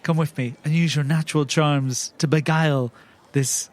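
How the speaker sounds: normal talking voice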